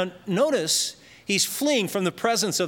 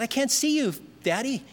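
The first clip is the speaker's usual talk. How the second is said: put-on higher-pitched, mildly pleading child's call; lightly voiced little boy; 7 semitones higher than usual. Little boy to his father down in the dark cellar, lightly voiced higher.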